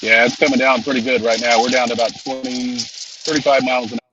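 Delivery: talking over rain drumming on the car; reporting from a car in heavy rain; rain on car roof. Real, not acted. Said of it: "I can hear that rain in your car".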